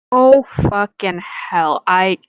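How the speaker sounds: ordinary talk, nothing marked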